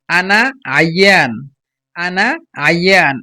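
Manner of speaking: আনা আইয়ান drawn out slowly, long aa in আনা; slow careful Arabic. Shown as a demonstration. Teacher models Egyptian phrase for students, slow and careful. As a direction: spell out আনা আইয়ান slowly for learners to copy. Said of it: "কিভাবে উচ্চারণ করতেছি সেই হিসাবে আপনারা উচ্চারণ করবেন".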